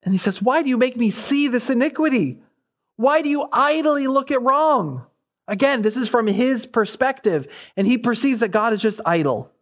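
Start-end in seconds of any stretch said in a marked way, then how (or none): 0.4-5.0 high, pleading acted protest; anguished complaint. Habakkuk questions God about wrong, in anguished complaint. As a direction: demand an answer, pitch pushed well up. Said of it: "anguishing or shrieking".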